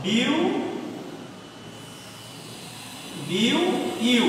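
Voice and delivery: বিউ repeated, then ইউ isolated; sounding out syllables. Shown as a demonstration. Teacher to class, sounding out syllables. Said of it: "খেয়াল করে দেখো".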